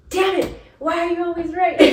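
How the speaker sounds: high-pitched, playful exasperated protest; mock exasperation. Client tells her therapist she is always right, mock exasperated. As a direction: complain in comic defeat at being out-argued.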